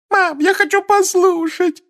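very high, slow whine; girl whining to her mother. Acted. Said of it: "захныкала Джинни".

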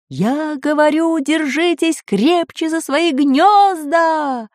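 high, slow drawn-out shout. A narrator's reading. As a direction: shout it over the storm. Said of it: "ещё громче крикнул географ".

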